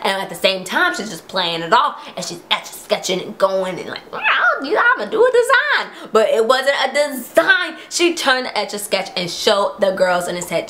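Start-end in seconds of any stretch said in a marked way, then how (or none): 4.1-6.1 hammed-up innocent small talk; fake casual. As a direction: Spencer faking casual chatter. Spencer playing it off.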